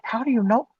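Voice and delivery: high, loud retold question; uneasy disbelief. Questioning the stranger who knows too much, uneasy disbelief. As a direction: act out being startled by what he knows. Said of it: "starting to feel very uneasy".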